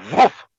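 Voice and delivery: high, loud whoosh; sudden magical puff. Acted. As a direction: make the genie's poof sound. Genie lamp rubbed, magic sound.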